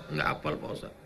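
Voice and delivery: whispered, embarrassed admission; sheepish whisper. Acted. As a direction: whisper it, ashamed to confess. Jemaah malu tak hafal. (bisikan malu-malu)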